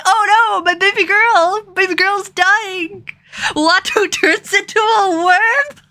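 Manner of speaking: high, slow mock wail; mocking chat. Mimicking the comment section's mock grief, high and slow. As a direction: ham up fake grief for the baby girl. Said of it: "and be like".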